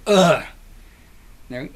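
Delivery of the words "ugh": loud, heavy mock groan; tired groan. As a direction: grunt as if all worn out. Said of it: "but I'm tired".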